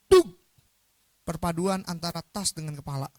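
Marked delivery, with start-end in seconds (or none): 0.1-0.3 sudden high, loud impact noise; loud thud. Retold or imitated. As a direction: imitate a bag thudding onto a head. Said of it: "bunyinya kenceng banget". (tiruan bunyi benturan keras)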